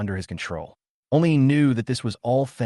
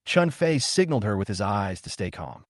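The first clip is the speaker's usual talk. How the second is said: ordinary delivery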